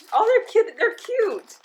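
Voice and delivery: high excited gushing. Real, not acted. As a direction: squeal over something cute. Gushing over cute candy.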